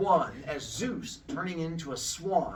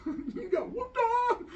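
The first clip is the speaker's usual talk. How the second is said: high-pitched mocking taunt; jeering friends. Storyteller as friends laughing at the beaten girl, jeering taunt. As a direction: tease the loser in a squeaky high voice. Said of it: "like, laughing".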